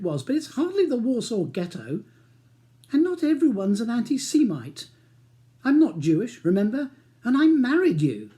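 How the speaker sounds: wife scolding husband, indignant; heated argument. Acted. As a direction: let the anger climb.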